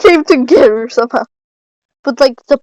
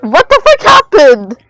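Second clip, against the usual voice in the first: startled outburst; 3 semitones higher, 6 dB louder than usual. Real, not acted. After a jump scare.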